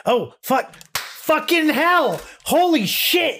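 high, stunned exclamation; shocked. Real, not acted. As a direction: blurt it out in shock. Finding the source reveal.

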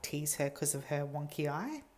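normal talking voice

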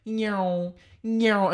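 high nyeow for cars speeding by; car zooming past. Retold or imitated. Describing drivers on the shoulder, car zoom noise. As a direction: zip the sound up like a passing car. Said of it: "just, like".

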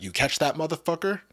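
ordinary talk

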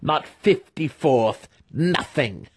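high, slow, mocking glee; the master gloating to the captive boy. A narrator's reading. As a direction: savour every word of the ruin. Master gloating over the fallen house.

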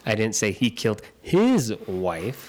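"his" stressed with a rise. Shown as a demonstration. His turn in the emphasis game, stress on "his". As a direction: point the line at "his". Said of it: "put the inflection on the second".

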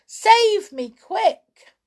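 very high, loud cry for rescue; frantic high-pitched plea; panicked shout. A narrator's reading. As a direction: yell urgently for help. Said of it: "he shouted".

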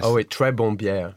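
English beginner's French; French with English accent. Shown as a demonstration. Guest plays host praising the beer, English-accented French. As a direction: pronounce the French as a Briton would. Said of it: "in my English accent".

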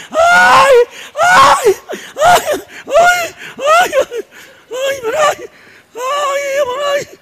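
high desperate wails of ay; wailing in pain. Acted. Man with toothache walking down the street, high wailing cries. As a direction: cry out ay, ay in toothache agony. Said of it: "Desesperado, llorando".

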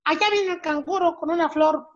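high, cheeky schoolboy; Pepito's voice. Acted. Pepito reciting a verse to his teacher, high.

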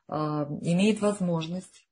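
ordinary talk

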